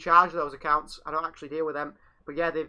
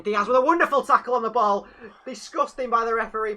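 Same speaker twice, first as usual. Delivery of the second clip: louder, higher, outraged at the ref; outraged fan commentary; indignant commentary; 5 semitones higher, 6 dB louder than usual. Real, not acted. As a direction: protest the call hotly. Commentating a disputed tackle.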